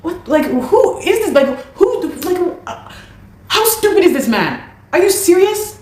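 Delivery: can't believe what she just heard, cry it out high; outraged disbelief. Real, not acted. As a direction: pitch shooting way up in shocked disbelief. Outrage at the unpaid rent.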